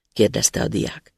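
plain reading voice

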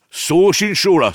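loud, slow, high refusal; sharp rebuke. A narrator's reading. Younger brother shutting down the uncle's beer, loud rebuke. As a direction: cut him off hard and loud. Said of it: "szólt rá az öccse".

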